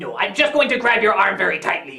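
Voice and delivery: low, unmoved villain voice; deadpan captor. Acted. Villain refuses Lucy's pleas, low and unmoved. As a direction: play the captor refusing, pitched well down.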